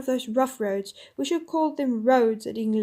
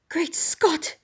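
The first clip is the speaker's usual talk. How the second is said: higher, bright astonished outburst; amazed exclamation; 5 semitones higher than usual. Acted. Eustace amazed at Jill's deed, higher exclamation. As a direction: blurt it out in amazement. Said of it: "said Eustace".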